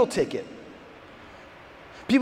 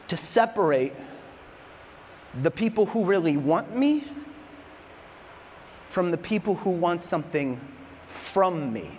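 earnest Jesus; 4 semitones lower, 6 dB quieter than usual. Retold or imitated. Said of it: "Jesus says".

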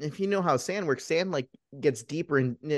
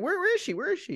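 much higher-pitched, panicked quote; mock frantic. Man demanding to know where his love is, mock frantic. Acted frantic husband searching for her.

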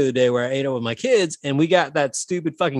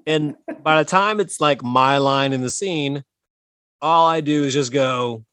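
ordinary talk, nothing marked

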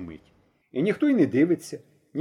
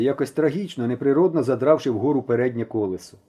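ordinary delivery

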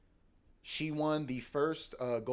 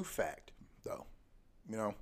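breathy whisper, much softer; whispered aside; 4 semitones lower, 10 dB quieter, slower than usual. Acted. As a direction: drop to a sly whisper. Whispered aside repeating fact.

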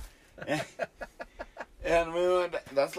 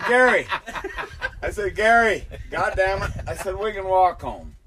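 louder, higher call across the road; calling out to Gary; 6 semitones higher, 6 dB louder than usual. Retold or imitated. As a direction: shout at Gary to stop him. Yelling at Gary burning car.

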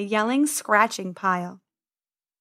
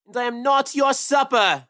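roaring. Acted. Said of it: "he roared".

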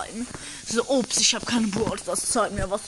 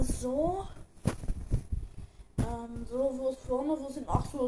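quiet muttering; 7 dB quieter than usual. Acted. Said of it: "Der, der sich nebenbei einfach mal anzieht".

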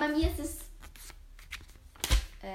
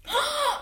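sharp high gasp of mock shock; surprised gasp; 13 semitones higher, 3 dB louder than usual. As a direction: gasp as if scandalized. Shocked by the Dumbledore pick.

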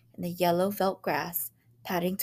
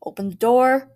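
loud, higher shout; shouted command; 6 semitones higher, 9 dB louder than usual. A narrator's reading. Father shouts at the locked door. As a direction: bang out the order. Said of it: "cried George Hadley".

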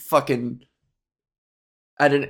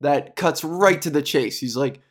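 normal talking voice

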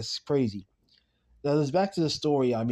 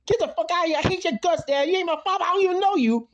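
very high, heated rant; high-pitched angry tirade; aggressive; 4 dB louder, faster than usual. Retold or imitated. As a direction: act out a son blowing up at his father. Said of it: "he gets aggressive".

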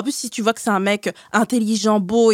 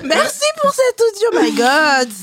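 high and breathless from laughing; after laughing hard; 9 semitones higher, 3 dB louder, slower than usual. Real, not acted. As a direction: still catching breath from laughter. Hosts still laughing at message.

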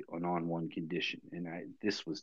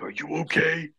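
higher, louder urgent check; emotional boss; 8 semitones higher, 7 dB louder than usual. Retold or imitated. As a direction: imitate the boss asking, pitched up and loud. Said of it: "He's very emotional".

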